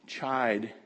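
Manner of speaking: ordinary talk, nothing marked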